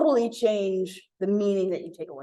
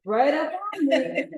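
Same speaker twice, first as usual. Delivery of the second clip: high, slow, over-polite unease; indirect hinting; 5 semitones higher, 3 dB louder than usual. Acted. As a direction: beat around the bush about discomfort. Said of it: "He's not being very direct".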